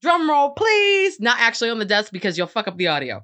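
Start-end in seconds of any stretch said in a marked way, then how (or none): 0.0-1.2 drum roll announcement. Real, not acted. Announcing news to listeners.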